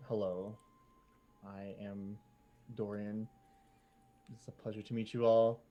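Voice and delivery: soft, refined frog lady; frog lady Dorian. Acted. Dorian excuses Jim to the crew, soft and refined. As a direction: speak quietly and graciously. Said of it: "this frog lady comes over and she says".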